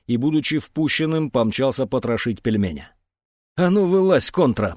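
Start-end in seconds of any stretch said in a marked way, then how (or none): 3.6-4.8 angry, high-starting command; growled order. A narrator's reading. Колька kicks the tractor to flush out Пельмень, growling. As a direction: order him out with a rough challenge. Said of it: "прорычал он, пнув ногой трактор".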